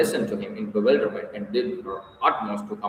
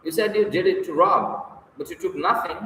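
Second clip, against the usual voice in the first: higher-pitched urgent questioning; quick, grasping question; 6 semitones higher, 3 dB louder than usual. A narrator's reading. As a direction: press for an answer, catching at a straw. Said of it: "she asked quickly, catching at a straw".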